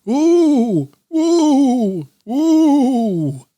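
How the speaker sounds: high, loud hooting shout; make-believe dragon hooting at the weasel. Acted. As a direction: boom it out to frighten the weasel. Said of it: "shouted in a very loud voice".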